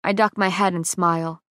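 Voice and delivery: usual speaking voice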